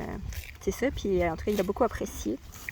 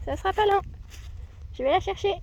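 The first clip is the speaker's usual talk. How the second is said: high sing-song; 10 semitones higher, faster than usual. Real, not acted. High sweet voice to horse.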